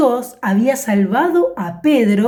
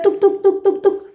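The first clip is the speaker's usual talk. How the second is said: knocking sound; 8 semitones higher than usual. Acted. Pedro knocks at the door.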